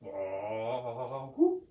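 a low bah that pops up into a hoot; goat bleat with hoot. Pretend. Puppet owl imitating the goats' hello. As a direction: bleat then let a hoot slip. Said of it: "It sounded like this".

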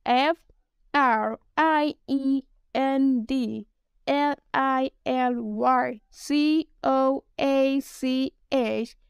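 slow, letter-by-letter spelling, each letter set apart; dictating a web address slowly. Shown as a demonstration. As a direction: spell it out slowly so they can write it down. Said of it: "Let me spell that for you".

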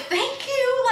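very high-pitched, drawn-out thank you; relieved thanks. Retold or imitated. Retold grateful relief at finally being defended.

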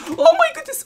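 very high, loud startled shriek. Real, not acted. Gamer jump-scared by a mob, sudden high shriek.

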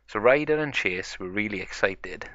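usual speaking voice

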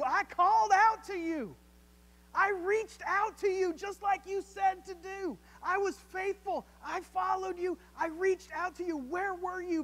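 high, loud, pleading voice of a grieving sister; anguished cry to God. Retold or imitated. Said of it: "You know how to paraphrase that?".

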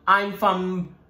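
halting, badly faked British accent; British accent attempt. Host tries being from Britain, badly faked British accent. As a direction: stumble into a poor British accent. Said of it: "I am terrible with any accent".

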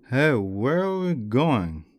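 put-on slow, loud slurred drunk; drunken slur. A narrator's reading. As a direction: slur it out drunkenly, dragging the words. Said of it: "Vico slurred, his voice nearly unintelligible".

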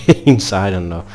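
normal talking voice

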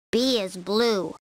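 ordinary delivery

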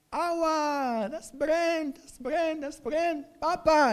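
put-on high, whiny little boy crying out; a child wailing that the stove burns; child in pain. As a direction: squeal it like a burnt child.

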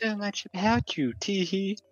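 put-on high girly voice with a giggle; sweet falsetto lady voice; man doing a woman's voice. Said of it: "Give it a girl voice".

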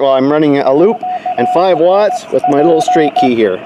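ordinary talk, nothing marked

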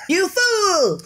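cartoon villain laughs as he leaves; hammed-up parting cackle; villain taunt. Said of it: "Lilliputian parting shot".

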